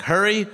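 "Hurry" for the name, rhyming with furry, lifted high; mispronounced name. Retold or imitated. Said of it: "people mispronounce it all the time".

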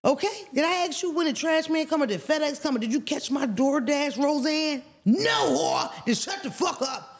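high, sharp clapback with attitude; sassy comeback. Pretend. What she would have snapped back at the neighbour, sassy and high. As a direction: tell her off like you've had enough. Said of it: "That's what I would have said".

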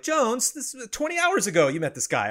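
high-pitched mock reminder; imitated reminder. Voicing others correcting him in a retelling.

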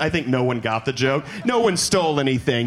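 ordinary delivery

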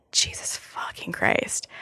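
breathy unvoiced whisper of disbelief; whispered curse. Retelling her reaction to his text, a stunned whisper. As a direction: hush it out like a stunned aside.

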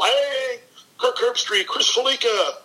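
high-pitched coach's hail; hearty greeting. Retold or imitated. Coach greeting two friends at breakfast in a hotel, high-pitched mock hail. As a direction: call the names out, imitating a coach.

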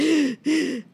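sharp, high imitated gasp of a flinching client; mock gasp. Lash artist mimicking a sensitive client, mock gasp. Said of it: "It's like".

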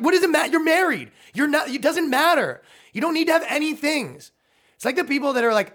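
comic ranting at a married woman, exasperated. Real, not acted. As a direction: throw your hands up at the absurdity.

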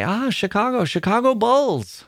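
high, bright-eyed Chicago fan greeting; excited Italian fan. Retold or imitated. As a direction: gush it like a thrilled Italian stranger. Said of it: "They would either say".

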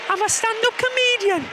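high Geordie comedian voice; chirpy high Geordie comedian; Sarah Millican impression. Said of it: "I'm Sarah Millican".

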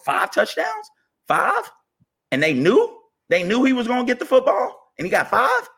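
can't believe five touchdowns, pitch way up; incredulous disbelief. Real, not acted. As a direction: voice shooting up high in outraged disbelief. Incredulous rant about a rival's player.

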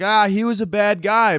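lightly voiced, high-pitched critic; mock naysayer. People dismissing Chapo as a bad guy, mock complaint. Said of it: "people like to be like".

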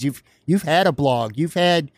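ordinary talk

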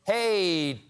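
high, loud fumbling hello; awkward greeting. Retold or imitated. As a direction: bluff through forgetting a name. Someone forgetting Truss's name.

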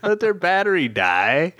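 high-pitched retold protest; mock exasperation. Telling friend not to help rude girls, mock exasperation. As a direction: scold a too-kind friend in a lifted, comic voice.

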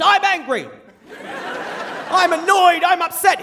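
high-pitched yelled feelings; mock scream. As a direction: imitate Dad hollering his feelings. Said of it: "hear this scream of just".

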